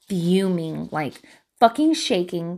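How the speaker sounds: ordinary talk, nothing marked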